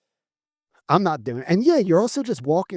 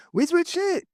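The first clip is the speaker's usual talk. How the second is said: squeaky repeat. Acted. Repeating the joke, pitched up.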